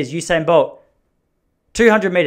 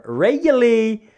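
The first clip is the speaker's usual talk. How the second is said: high-pitched; 8 semitones higher, 4 dB louder, slower than usual. Real, not acted. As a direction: voice leaps up high and loud. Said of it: "that high-pitched thing with my voice".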